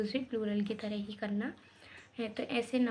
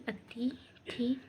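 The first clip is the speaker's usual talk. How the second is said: whispered word; 5 dB quieter, much slower than usual. Shown as a demonstration. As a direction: whisper the word softly and slowly. Teacher whispers the word again.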